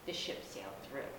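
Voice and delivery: usual speaking voice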